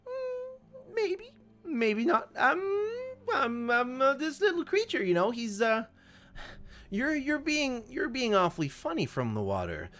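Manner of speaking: high, coy, playful gnome voice; coy old gnome. Acted. Gimbal teasing the creature about the invitation, high and coy. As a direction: tease the creature coyly.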